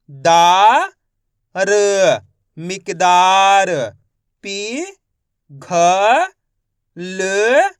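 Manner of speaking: slow, loud, one syllable at a time, then blend the word; syllable-by-syllable reading drill. Shown as a demonstration. Teacher sounding out Hindi words for pupils, loud and deliberate. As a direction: spell each piece out clearly for young readers.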